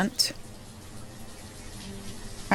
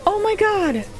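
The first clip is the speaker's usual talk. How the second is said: disbelief; 9 semitones higher, 4 dB quieter than usual. Real, not acted. Tornado kills colonists.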